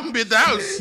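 laughing. Real, not acted. Laughing about the bomb plan.

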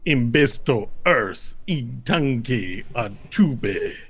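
slow, emphatic alien pronouncement; Tabacho speaking Draconic. Acted. Tabacho reacting to Earth, slow Draconic pronouncement. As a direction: stretch and punch each foreign word.

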